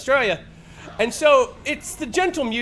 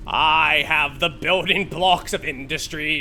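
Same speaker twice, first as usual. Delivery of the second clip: loud, slow villainous factory chant; hammed-up robber baron voice; gleeful industrialist; 6 dB louder, slower than usual. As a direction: hum and gloat like a heartless tycoon. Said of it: "Rabbit, Iron Man, industrialist".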